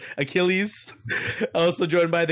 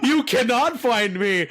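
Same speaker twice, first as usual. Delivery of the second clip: mock mysterious; 10 semitones higher, 3 dB louder, slower than usual. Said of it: "he's like".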